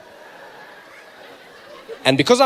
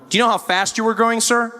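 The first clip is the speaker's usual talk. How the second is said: higher officer's questioning voice; American policeman; 4 semitones higher than usual. Retold or imitated. Policeman quizzing pulled-over driver, raised officer voice. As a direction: imitate a formal American cop. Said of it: "Policeman walked up to my window".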